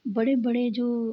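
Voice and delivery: ordinary delivery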